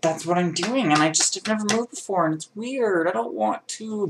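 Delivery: high, put-on reluctant whine; mock whining. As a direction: whine it way up high like a child resisting. Mock reluctance about moving, then drops it.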